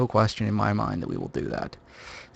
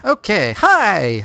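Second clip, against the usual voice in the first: excited greeting; 8 semitones higher, 6 dB louder, much slower than usual. Real, not acted. Stream opening greeting.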